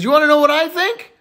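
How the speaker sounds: put-on eager high-pitched kid; little boy. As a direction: pester Mom in a child's high voice. Little boy pesters his mom.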